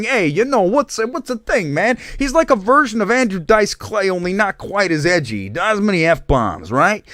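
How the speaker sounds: high-pitched, hammed-up Italian-American accent. Host imitating an Italian stand-up comedian, bad Italian accent. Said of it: "I do the whole thing like this".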